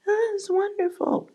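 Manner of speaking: squeaky high fake-enthused falsetto; fake praise. Woman faking praise for her lover, high and sugary. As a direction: gush way up high, clearly faking it. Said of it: "she goes".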